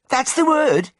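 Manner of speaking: very high triumphant Brummie cry; high, thrilled outburst; excited exclamation. A narrator's reading. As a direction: seize on it with delight, pitched right up. Patient recognises the word.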